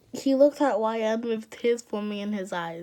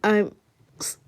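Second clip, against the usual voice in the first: loud, halting apology; tearful apology; 8 dB louder than usual. A narrator's reading. Michael apologizing to Chris for bullying, choked up. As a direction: push it out in broken pieces. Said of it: "he said, crying".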